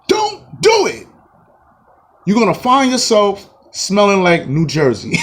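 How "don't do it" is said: very high, slow, drawn-out warning. Pretend. Warning Kevin Durant off the Nets, slow and very high. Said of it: "pleading with Durant".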